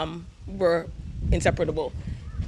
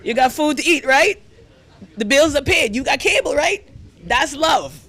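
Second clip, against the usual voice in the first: higher, louder, rising "right?" at each line; gruff father; 4 semitones higher, 3 dB louder than usual. Retold or imitated. Mother quoting the kids' dad listing what he gives, rising. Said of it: "Your dad used to always tell you".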